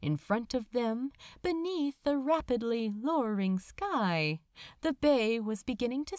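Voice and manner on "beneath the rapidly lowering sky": usual speaking voice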